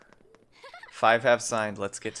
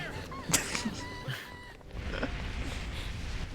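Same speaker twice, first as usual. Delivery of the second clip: high squeaky laugh; 11 dB quieter than usual. Real, not acted. Laughing at streamer.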